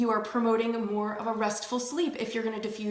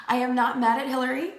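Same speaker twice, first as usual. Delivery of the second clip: louder, chanted mantra line; playful self-help affirmation; recited affirmation; 3 semitones higher, 5 dB louder than usual. Shown as a demonstration. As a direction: recite it for them to repeat. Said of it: "Repeat after me".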